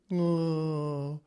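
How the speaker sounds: mopey Eeyore sigh. Retold or imitated. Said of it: "Eeyore Christians".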